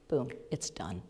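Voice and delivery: ordinary talk, nothing marked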